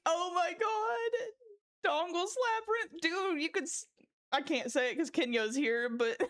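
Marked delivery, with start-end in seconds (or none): 0.1-3.9 high squealing laugh-cry through the words; helpless giggle breaking out high. Real, not acted. Streamer losing it at chat's titles, laughing.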